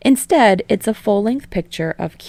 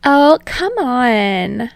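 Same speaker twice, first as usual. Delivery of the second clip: high, slow whine; high drawn-out girlish whine; whining; 6 semitones higher, 6 dB louder, much slower than usual. Acted. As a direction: whine it like a bored girl. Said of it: "Ashido whines".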